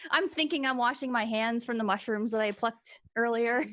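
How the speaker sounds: usual speaking voice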